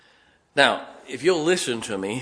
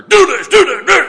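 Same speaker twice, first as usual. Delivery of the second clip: much higher, very loud barked commands; six-foot-four drill instructor shouting orders; 16 dB louder, faster than usual. Retold or imitated. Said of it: "that six-foot-four drill instructor".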